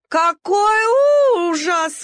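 very high, drawn-out howl of horror; howling wail. Acted. Dachshund howling in horror at the stray. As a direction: wail it, stretching every sound. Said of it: "взвыла Нелли".

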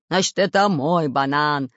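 loud, slow growl; heavy, drawn-out pirate roar. A narrator's reading. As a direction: growl back as the pirate boss. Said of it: "рычал Буль-Буль".

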